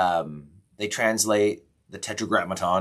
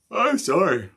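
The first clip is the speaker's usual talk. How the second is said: talking through a yawn; apology stretched by a yawn; 11 semitones higher, 6 dB louder than usual. Real, not acted. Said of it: "I'm yawning a lot this morning".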